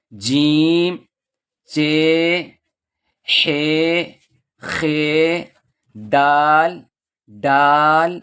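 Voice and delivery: teacher calling out letters one by one for the class; alphabet recitation. Shown as a demonstration. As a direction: recite the alphabet slowly for learners to repeat.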